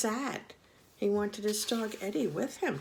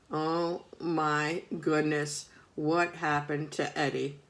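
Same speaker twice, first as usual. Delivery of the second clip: ordinary delivery, nothing marked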